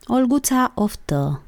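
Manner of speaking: plain reading voice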